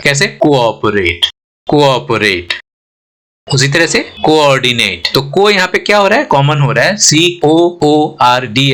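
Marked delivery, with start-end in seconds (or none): 0.3-2.5 कोऑपरेट in a lower teaching voice, 'ko' then 'op'; two separate o's. Shown as a demonstration. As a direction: sound both o's apart in कोऑपरेट. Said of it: "ये double ओ अलग-अलग sound create करेगा". (कोऑपरेट में दोनों ओ अलग-अलग)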